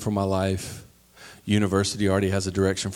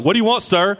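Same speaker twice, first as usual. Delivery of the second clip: aggressive order taker; 9 semitones higher, 5 dB louder than usual. Retold or imitated. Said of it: "who's taking your order is so aggressive".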